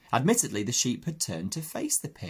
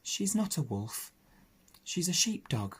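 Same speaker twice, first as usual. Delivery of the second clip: hurt; 7 semitones higher, 4 dB quieter than usual. Acted. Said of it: "in a rather hurt voice".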